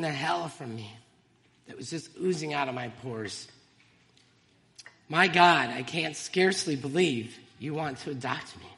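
reads her own poem aloud, heartfelt and measured; poem reading. Real, not acted. Testifying to the congregation by reading her poem, earnest recital. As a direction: let each line land like a confession. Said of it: "And I'd like to share it with you".

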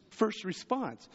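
usual speaking voice

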